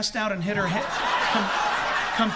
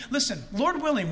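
normal talking voice

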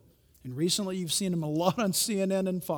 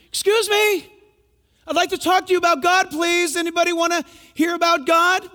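high, loud and fast street call; calling to a crowd; 11 semitones higher, 10 dB louder than usual. Retold or imitated. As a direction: grab the passers-by's attention. Preaching to crowd in Red Square.

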